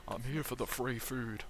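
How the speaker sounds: slow, quiet sidekick answer; Fredbear. Acted. Fredbear explaining why he's here, slow soft character voice. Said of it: "Hey, Fredbear".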